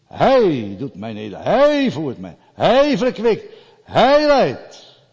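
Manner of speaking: loud, high preaching voice; fiery preaching. Acted. Preacher driving the psalm home to his listeners, loud and high. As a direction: hammer each line home.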